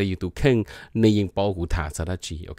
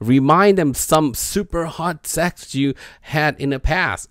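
slow headline read; 7 semitones higher, much slower than usual. Acted. Reads English headline slowly.

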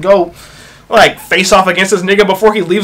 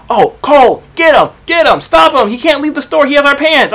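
high-pitched imitation of panicked staff; urgent mock alarm; 7 semitones higher than usual. Coworkers calling Cole to stop a man leaving, urgent mock alarm. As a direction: raise the voice high, imitating an urgent radio call.

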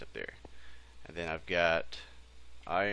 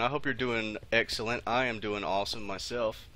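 normal talking voice

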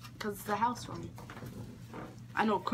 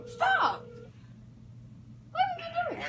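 high surprised yelp. Real, not acted. Reacting to friend.

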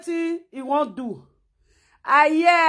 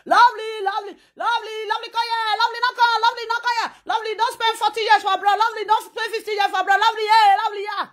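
gushing welcome; 8 semitones higher, much faster than usual. Retold or imitated. Gushing host calling guest over.

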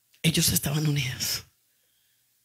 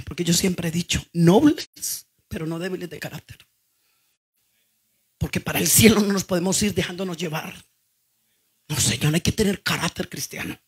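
usual speaking voice

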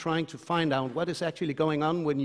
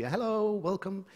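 higher, drawn-out hello; friendly welcoming call; 6 semitones higher than usual. Acted. Danes waving to the passing British Navy, mock friendly greeting. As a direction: call out a slow, lilting, higher-pitched welcome.

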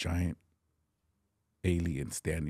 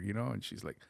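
ordinary talk, nothing marked

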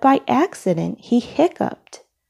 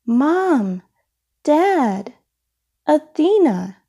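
higher, calling out names across the house; shouting; 7 semitones higher, slower than usual. A narrator's reading. Midas calls desperately for his missing family, shouting higher. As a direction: call them from the top of the stairs. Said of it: "he shouted".

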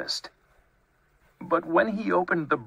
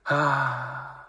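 relieved sigh. A narrator's reading. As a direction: let out a contented breath. Bear relieved to find no mouse.

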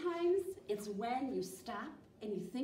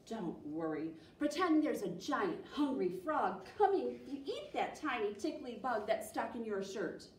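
plain reading voice

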